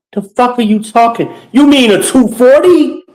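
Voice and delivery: imitating the laundry guy. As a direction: pitch way up and toss it off like a baffled worker. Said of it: "like the dude in the laundry room".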